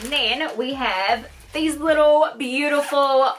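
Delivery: ordinary talk, nothing marked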